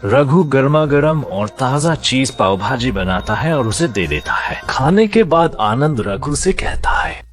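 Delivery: usual speaking voice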